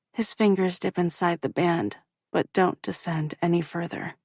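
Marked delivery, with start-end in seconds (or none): none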